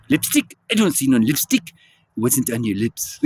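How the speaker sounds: lightly voiced French husband playing innocent; Merovingian. Husband answering his wife about the lipstick, mock French innocence. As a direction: imitate a Frenchman dodging his wife's accusation. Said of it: "Then he says".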